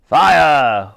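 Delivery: loud military command; ordering. A narrator's reading. The Admiral orders the next shot, loud command. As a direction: bark the firing order. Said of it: "ordered the Admiral".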